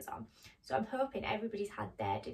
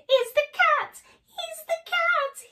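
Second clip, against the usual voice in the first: very high, loud mouse squeal; panicked cry; 13 dB louder, slower than usual. Acted. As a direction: cry out in exaggerated storybook terror. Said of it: "he cried".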